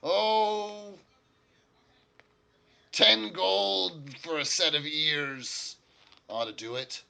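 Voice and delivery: high, loud start that settles down; dwarf making an offer. Acted. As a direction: announce the price loudly as the dwarf. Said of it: "the dwarf will probably turn and say".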